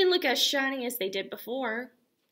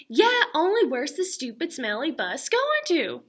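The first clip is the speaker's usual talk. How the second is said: louder, higher mock-frustrated kid; child frustrated about the bus, mock-angry; mad and frustrated; 5 semitones higher, 7 dB louder than usual. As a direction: stamp your foot in words. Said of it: "she's getting really mad and frustrated".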